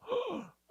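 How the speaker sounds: sudden high-pitched gasp; sharp gasp. Retold or imitated. Man retelling a risky make-out moment, sudden gasp. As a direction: suck in a sharp breath.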